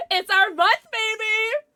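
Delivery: high, loud hype yell; excited holler with a laugh; celebratory shout. Real, not acted. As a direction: whoop it out proudly.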